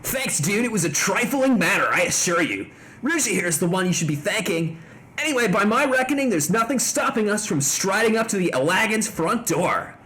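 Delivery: chill surfer dude. Acted. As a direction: talk like a stoned beach bum. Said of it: "I changed it to surf's up".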